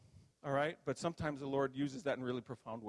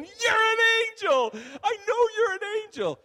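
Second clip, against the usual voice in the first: hysterical gleeful shouting; 15 dB louder than usual. Retold or imitated. Dreamer slapping the angel and laughing, acted-out high gleeful shriek. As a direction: shriek it as if helpless with glee. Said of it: "and I'm laughing. I'm going".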